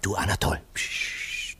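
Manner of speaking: hushed whisper. Acted. Max whispering to Anatol beside the sleeper. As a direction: hush so she won't wake.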